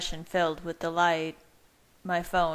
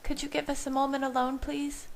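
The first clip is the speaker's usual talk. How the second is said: higher voice for Bella, gentle request; Bella speaking softly; 8 semitones higher, faster than usual. A narrator's reading. Bella gently asks the visitor to leave, higher voice. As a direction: ask him for a moment alone, higher and gentle. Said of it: "she said softly".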